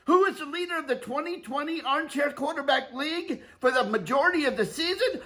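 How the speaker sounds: rising hype announcer build-up. Pretend. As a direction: build the crowd up before the call.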